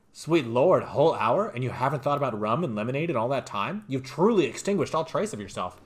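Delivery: loud, theatrical outrage; booming inner voice. Acted. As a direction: bellow it like a scandalized inner voice. Skill voice scolds the player.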